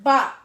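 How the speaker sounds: loud, punchy "bop" for a hit; one loud, short imitated blow; imitated smack. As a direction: punch out a sudden smack noise. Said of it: "I said".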